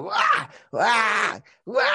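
put-on loud barking cough like an old man; mock old man's barking cough shouted out; dog-like barking cough. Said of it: "Gritando así".

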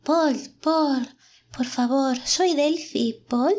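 high, slow, pleading phantom; a ghost begging to be recognised; whispering ghost. A narrator's reading. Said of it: "el fantasma de Peborg o lo que sea susurra". (voz de fantasma suplicante)

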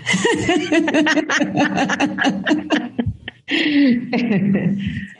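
short laugh at the glitch; embarrassed laugh. Real, not acted. Laughs at the connection trouble.

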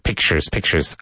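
"pictures" said as pikchers, not as spelt; spoken pronunciation. Shown as a demonstration. Teacher shows how "pictures" really sounds. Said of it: "it's spelled pictures, but".

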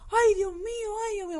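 high, crying panic, imitating mother; mother crying out as she sees the fire; panicked wailing. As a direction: wail in a tearful mock panic. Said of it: "mi madre gritando".

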